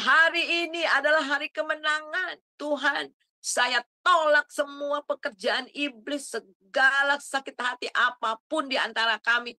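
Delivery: loud, high triumphant declaration; joyful proclamation. Retold or imitated. Woman driving home, declaring victory over the devil loudly.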